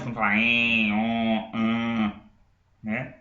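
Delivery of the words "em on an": "em, on, an" held as humming nasal vowels. Shown as a demonstration. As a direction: let "em, on, an" come out through the nose. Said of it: "parece que tá saindo do nariz".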